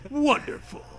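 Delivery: high, mock-bright wonderful; sarcastic. Mocking the story's wonderful event, high and sarcastic. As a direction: say it dripping with irony.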